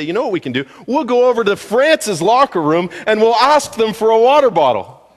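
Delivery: team voiced. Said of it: "and they say".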